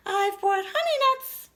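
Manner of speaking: high, grinning animal voice; grinning Badger. Badger offering honey nuts to the animals, grinning and high. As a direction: sound pleased with your gift of nuts. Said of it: "Badger says with a grin".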